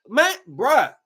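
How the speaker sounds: ordinary delivery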